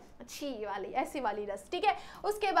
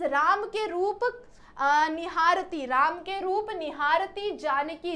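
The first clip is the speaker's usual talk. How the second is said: very slow recited verse line; slow verse reading; 2 semitones higher, slower than usual. Shown as a demonstration. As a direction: stretch the verse out slowly. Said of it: "recites verse slowly".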